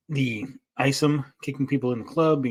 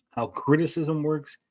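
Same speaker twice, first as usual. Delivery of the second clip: normal talking voice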